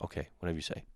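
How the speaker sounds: soft, giving-in reply; low-key, resigned murmur. Acted. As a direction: concede quietly, not convinced. Resigned reply to taxi driver.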